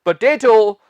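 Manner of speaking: पोटैटो with a long "tay" middle; correct pronunciation. Shown as a demonstration. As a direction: say पोटैटो as "puh-TAY-toh". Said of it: "वो क्या कहेंगे?".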